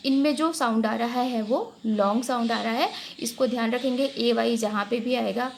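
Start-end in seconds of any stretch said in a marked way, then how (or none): none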